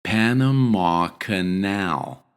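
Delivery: Panama Canal said slowly; slow model of Panama Canal; slow pronunciation demo. Shown as a demonstration. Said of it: "we also have the Panama Canal".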